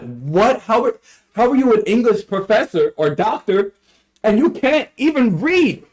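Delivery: high, stunned disbelief that climbs as it goes; incredulous outrage. Retold or imitated. Retelling outrage at the professor.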